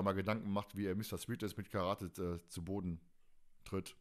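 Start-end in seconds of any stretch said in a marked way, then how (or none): none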